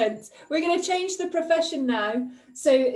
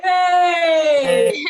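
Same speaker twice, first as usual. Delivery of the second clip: loud, drawn-out celebratory cheer; real cheer; 4 semitones higher, 10 dB louder than usual. Real, not acted. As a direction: cheer the punchline loudly. Crowd cheers the punchline.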